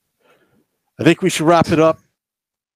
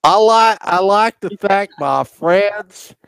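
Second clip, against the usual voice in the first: high, slow Texan put-on; badly faked Texas accent; mock Texas accent; 6 semitones higher, slower than usual. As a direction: draw out a hammed-up Texas thank-you speech. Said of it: "a Texas accent if my life depended on it".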